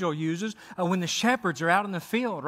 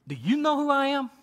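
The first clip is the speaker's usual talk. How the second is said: put-on high, accented angel asking who he is; Gabriel's angel voice with a light accent; 7 semitones higher than usual. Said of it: "a little, little accent added there".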